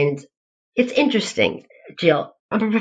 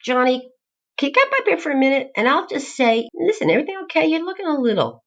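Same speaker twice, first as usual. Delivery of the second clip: higher, gentle call to a student, quick on the ask; caring professor; 11 semitones higher, faster than usual. Retold or imitated. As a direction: invite him up kindly, like a mother. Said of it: "I'll say".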